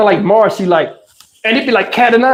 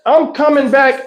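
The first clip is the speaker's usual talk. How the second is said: ordinary talk, nothing marked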